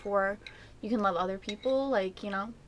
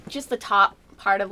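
ordinary talk, nothing marked

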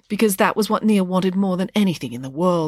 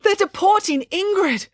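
very high, frantic alarm; acted distraught wail of bad news; pleading; 14 semitones higher than usual. A narrator's reading. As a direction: blurt it out in a panic. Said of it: "pleaded Loretta".

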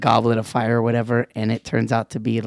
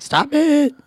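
put-on higher pitched annoyed child; annoyed daughter. Daughter fed up with her dad's teasing, whining. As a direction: whine it like a fed-up kid. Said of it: "And she's like".

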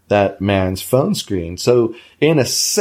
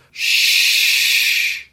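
calm shush. Shown as a demonstration. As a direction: shush the dog calmly. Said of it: "put my hand on his chest and go".

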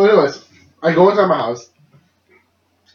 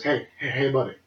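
soft, quiet wake-up voice; imitating a hushed, tender father; gentle dad; 2 semitones lower, 10 dB quieter than usual. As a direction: gently rouse a sleeping kid. Dad gently wakes drunk son.

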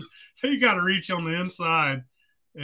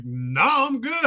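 retold startled answer; 7 semitones higher, slower than usual. Retelling own answer to operator.

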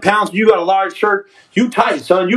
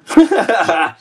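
laughing. Real, not acted. Livestreamer amused by a viewer's comment, laughing. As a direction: break into a quick laugh.

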